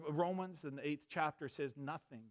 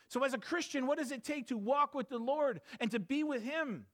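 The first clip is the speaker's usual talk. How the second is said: high, loud, driving sermon voice; fiery revival preaching; full-force preaching; 10 semitones higher, 4 dB louder than usual. Acted. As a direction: preach it hard to the congregation.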